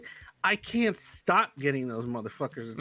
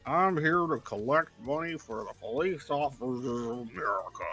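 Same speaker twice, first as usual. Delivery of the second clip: robocall sheriff; 14 dB louder than usual. Retold or imitated. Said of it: "that fucking bot call".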